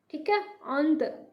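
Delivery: अंत said slowly with a faint nasal ग sound; slow nasal demo. Shown as a demonstration. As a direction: stretch अंत so a hint of ग is heard. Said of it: "ग की थोड़ी सी ध्वनि सुनाई दे रही है". (अंत को धीरे बोलें, ग की हल्की ध्वनि के साथ)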